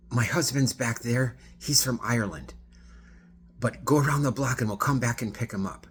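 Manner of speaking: excited passenger explaining to driver; animated explanation. Retold or imitated. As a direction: gush it out like a tipsy fun passenger. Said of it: "she's talking like this, very animated".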